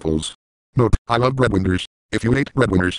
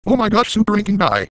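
much higher, fast chipmunk-like robot voice; high sped-up Sam voice; 9 semitones higher than usual. Pretend. Squeaky high voice mocking a grounded threat maker. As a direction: play a squeaky sped-up text voice yelling threats. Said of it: "using Sam's voice in a high pitch, while at full speed".

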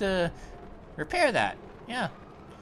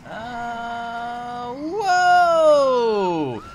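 surprised whoa; 5 dB louder than usual. Real, not acted. Sudden crash in game.

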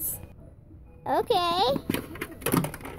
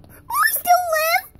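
pretend hurt doll; 6 semitones higher than usual. Hurt doll asks if alive.

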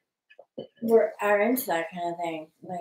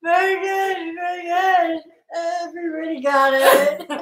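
loud mock praise; 7 semitones higher, 4 dB louder than usual. Real, not acted. Mock praise after impression.

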